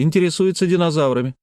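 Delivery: put-on high, quick childish put-down; scornful little girl. A narrator's reading. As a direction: toss it off like a bratty child. Girl scorns boys' interest.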